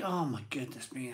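usual speaking voice

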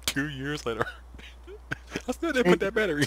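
laughing through the words, voice jumping up. Real, not acted. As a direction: chuckle it out at the joke. Laughing at his own joke.

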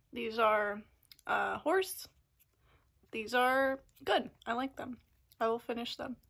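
talking around food; mouth full, chewing. Real, not acted. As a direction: cracker at the lips. Seen 3: Holds a bitten cracker at her lips while speaking after biting it.

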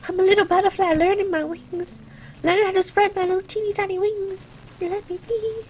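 high, small pleading voice; little butterfly. Pretend. Playful plea to critics while learning, high little-creature voice. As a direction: play tiny and helpless, stretching the last plea.

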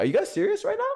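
high, squeaking disbelief; incredulous. Real, not acted. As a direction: voice cracks up in shock. Outraged at chat's pick.

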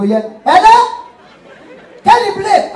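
squeaky high put-on caller, slow; phone caller voiced high and slow.

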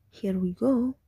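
ordinary talk

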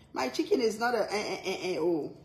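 ordinary talk

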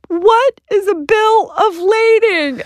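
high, slow, teary plea; near-sobbing helplessness, drawn out; tearful wail. Retold or imitated. Overwhelmed founder crying in the store aisle, slow high tearful wail.

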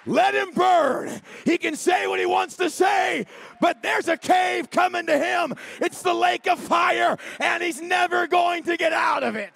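roaring, high preacher's shout; full-force preaching. Acted. Preacher shouting at the congregation, full force. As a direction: thunder it at the congregation.

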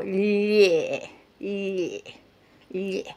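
"lie" as one long squashed lle, fading; palatal lh demo. Shown as a demonstration. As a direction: stretch "lie" into lle with a disgusted grimace. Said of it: "hacer cara de asco".

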